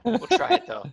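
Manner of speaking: a quick hammed-up Tony voice. DM tries to get back from Tony, still in voice. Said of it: "I slipped into Tony".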